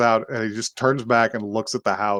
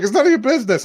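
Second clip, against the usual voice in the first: mock defensive retort; 12 semitones higher, 4 dB louder, much faster than usual. Sister's retort in acted sibling quarrel.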